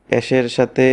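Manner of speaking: ordinary talk, nothing marked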